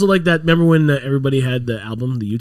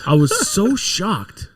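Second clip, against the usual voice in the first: very slow, higher "so shocked"; stress on SO; 5 semitones higher, much slower than usual. Real, not acted. Storyteller repeats his surprise to a friend, stretched emphatic "so".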